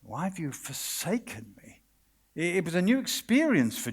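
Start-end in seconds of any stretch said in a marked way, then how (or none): 0.0-1.8 much softer, hushed anguish; forsaken cry. Acted. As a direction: barely voice the plea. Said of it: "It's Jesus crying".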